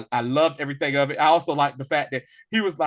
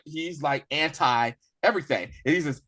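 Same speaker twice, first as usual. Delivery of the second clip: ordinary delivery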